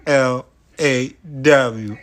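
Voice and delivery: L A W spelled letter by letter, slow; spelled out slowly. Shown as a demonstration. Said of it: "It's the law".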